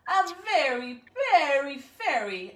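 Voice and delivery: high, loud grand proclaiming; showy fairy voice. Acted. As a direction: flourish it like a proud fairy. Bear tooth fairy announcing herself.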